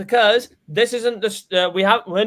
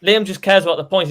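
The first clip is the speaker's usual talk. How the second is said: ordinary talk, nothing marked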